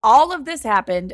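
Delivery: normal talking voice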